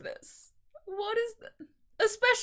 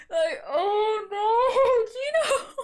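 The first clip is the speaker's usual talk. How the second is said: slow, high wail of laughing tears; drawn out, half sob half laugh; laugh-crying; 9 semitones higher, 4 dB louder, much slower than usual. Real, not acted. Overwhelmed fan near tears.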